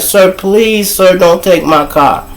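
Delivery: high-pitched polite pleading; imitated begging. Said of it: "she was begging him".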